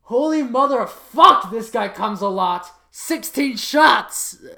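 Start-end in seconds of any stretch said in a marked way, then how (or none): 0.0-1.5 loud, high shocked outburst; astonished exclamation. Real, not acted. 2.9-4.4 slow, loud, high in awe; amazed, drawn out. Real, not acted.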